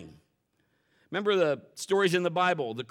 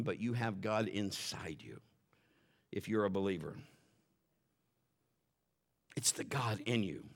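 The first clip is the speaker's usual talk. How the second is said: preacher whispering to the congregation; 9 semitones lower, 8 dB quieter than usual. Real, not acted. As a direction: lean in and breathe it like a secret. Preacher drops to intimate point.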